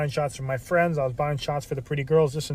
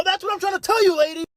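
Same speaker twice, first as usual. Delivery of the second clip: very high-pitched, exasperated mock yell; acted fed-up clerk losing it; exasperated outburst. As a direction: blow up at a clueless customer. Fed-up clerk finally snaps at customer.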